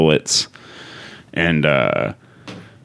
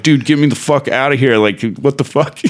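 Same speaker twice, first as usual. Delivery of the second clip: panicked plea; 8 semitones higher than usual. Retold or imitated. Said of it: "I'm like".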